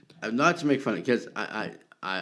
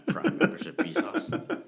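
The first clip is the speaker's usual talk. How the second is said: high, squeaky plea to Bezos; mock plea; 10 semitones higher than usual. Begging Bezos after joking about cancelling Prime, squeaky and high. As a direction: squeak his name like a frightened servant.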